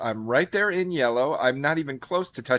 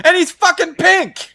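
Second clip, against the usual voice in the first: high, loud, drawn-out exclamation; emphatic shout; 8 dB louder, slower than usual. Real, not acted. Insisting to friends that Frieza is pink, emphatic shout. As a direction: shout it slowly as the clinching proof. Heated mock argument over Frieza.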